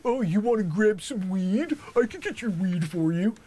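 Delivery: mock LA dealer. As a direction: pitch it like a pushy street seller. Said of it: "they're like".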